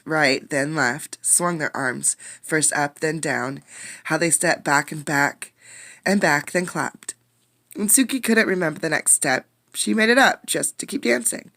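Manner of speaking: put-on lively read-aloud for young kids; story reading. A narrator's reading. A teacher reading a storybook to her class, lively. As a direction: bring the picture book to life.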